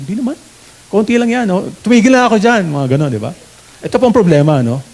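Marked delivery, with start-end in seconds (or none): 0.0-2.7 mock excuse. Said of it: "Sabihin natin".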